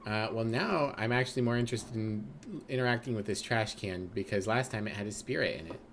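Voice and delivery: normal talking voice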